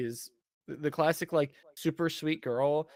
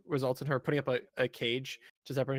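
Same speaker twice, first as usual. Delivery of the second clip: ordinary talk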